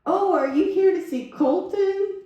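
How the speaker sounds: high, slow voice of a shelter lady. Retold or imitated. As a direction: put on a drawn-out, high greeting. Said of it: "the lady said".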